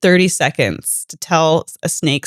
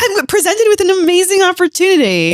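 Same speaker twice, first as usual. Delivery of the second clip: excited; 13 semitones higher than usual. Real, not acted. Defending his snake offer.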